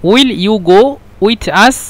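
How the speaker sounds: slow. Shown as a demonstration. Teacher translating slowly for learners.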